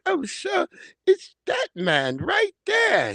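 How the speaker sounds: high, weepy pointing-out voice; hammed-up sobbing accuser. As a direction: play the fragile victim, high and teary. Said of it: "And she's like".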